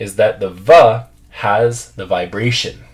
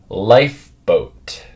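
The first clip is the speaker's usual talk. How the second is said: "Lifeboat" drawn out slowly, f made with lip on teeth; careful slow repeat of "Lifeboat"; slow model word; much slower than usual. Shown as a demonstration. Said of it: "the next one".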